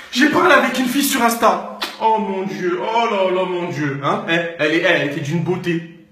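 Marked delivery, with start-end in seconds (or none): none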